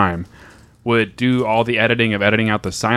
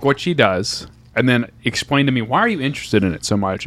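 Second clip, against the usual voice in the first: ordinary talk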